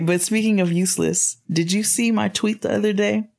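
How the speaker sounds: usual speaking voice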